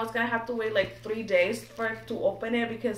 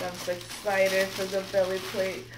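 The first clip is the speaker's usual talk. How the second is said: ordinary talk, nothing marked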